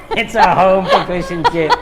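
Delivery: loud, amused repeat; laughing. Real, not acted. Stylist delighted by the definition, laughing as repeating it. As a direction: say it through a laugh.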